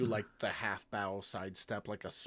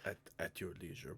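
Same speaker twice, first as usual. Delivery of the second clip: normal talking voice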